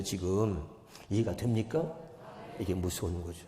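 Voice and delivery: ordinary talk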